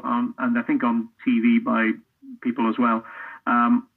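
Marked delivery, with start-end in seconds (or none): none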